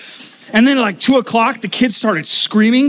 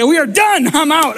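higher, emphatic giving-up cry; exasperated outburst; 6 semitones higher than usual. Retold or imitated. Fed-up guest giving up on the night, high exasperated cry. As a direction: throw up your hands, done with it.

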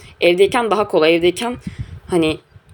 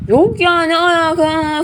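shrill mock protest, pitched up high; indignant denial; 9 semitones higher, much slower than usual. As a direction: wave the idea away, offended. Retold brushing off mom's question.

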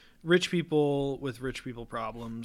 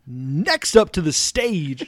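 slow, loud announcer; 4 semitones higher, 12 dB louder, much slower than usual. Acted. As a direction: call it like a stage host. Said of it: "my announcer voice".

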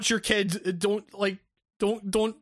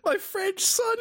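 crying with laughter, high; laughing mock wail; 10 semitones higher, slower than usual. Parody father wailing, overcome by laughter. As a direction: wail it while cracking up.

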